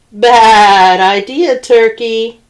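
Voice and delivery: loud, slow warning; slow it down and scold; stern rooster. Acted. Rooster warning Turkey off, loud slow scolding.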